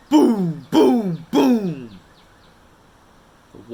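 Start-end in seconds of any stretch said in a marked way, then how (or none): 0.1-2.0 loud, falling booms; big loud booms; booming. A narrator's reading. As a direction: boom each one out like a drum. Narrator voices the booms.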